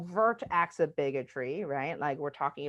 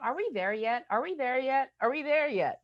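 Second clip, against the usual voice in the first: higher-pitched mock kid, rising with each repeat; kids pestering; 7 semitones higher than usual. Little kids nagging parents on a road trip, pestering. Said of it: "they're like".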